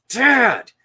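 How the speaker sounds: loud, high-pitched call for attention; urgent shout. Retold or imitated. Boy warning his dad about a bear, urgent shout. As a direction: yell it like a kid who keeps being ignored.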